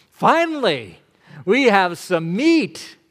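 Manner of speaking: high, loud, eager relief; excited. Acted. Hungry Israelites cheering at last for meat, excited. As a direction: voice the hungry crowd's glee.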